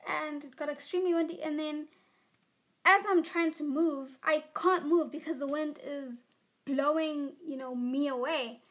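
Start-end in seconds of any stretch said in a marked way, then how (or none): none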